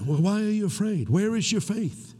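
high, lightly voiced Jesus questioning; gently rebuking Jesus. Jesus asking his frightened disciples about their faith, high rebuke. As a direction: ask it up high as a teacher chiding.

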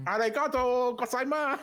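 high, loud Arigato gozaimasu; hammed-up cartoon Japanese thanks; shouting thanks like a cartoon; mock Japanese thanks. Comic Japanese sign-off.

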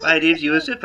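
ordinary talk

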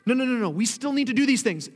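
fast, loud, high rush of no no no; panicked insistence; frantic protest. Retold or imitated.

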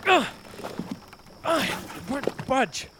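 straining, then a sharp cry; fighting to pull free. Acted. Oar stuck, creature pulls.

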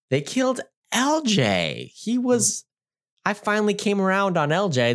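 high, aggrieved fan outcry; indignant protest. Real, not acted. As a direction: protest a favorite's death, pitch way up. Mock outrage at character's death.

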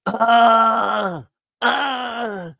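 high, loud cry of pain; mock pained cry. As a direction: wail like you've just been shot. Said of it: "the guy's like that".

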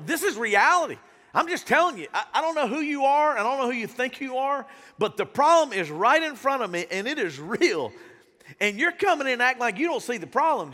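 indignant crowd member pushing back; exasperated arguing. Acted. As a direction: argue back, insisting on the facts. Crowd argues with Jesus in story.

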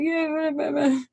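high, soft wordless whimper; babbled fake crying; mock blubbering. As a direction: imitate a kid blubbering an answer. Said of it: "I was crying".

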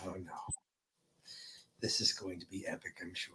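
dry sarcastic whisper. Real, not acted. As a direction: whisper it with a wry grin.

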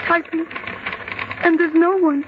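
panicked disbelief; rising terror. Acted. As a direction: fear climbing in every word. Hears typing, nobody there.

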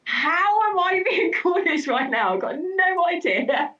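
high, laughing incredulous question; laughing disbelief. Retold or imitated. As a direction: laugh through the baffled reply. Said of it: "And I was like".

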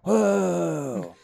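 drawn-out movie-star whoa; Keanu Reeves. Acted. Puppet gives the room his actor impression, drawn-out awe. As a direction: let one falling syllable hang, impression style. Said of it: "give us a little Keanu".

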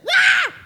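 piercing mock scream, very high and loud; hammed-up shriek of fright. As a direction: shriek like the wife spotting the lizard. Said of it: "She was like".

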